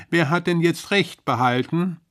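gloating call. Acted. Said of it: "rief die Rinde von oben".

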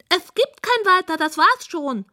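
high-pitched pig indignation; huffy pig. A narrator's reading. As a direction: snap back in a high piggy voice. Offended pig ends her recital.

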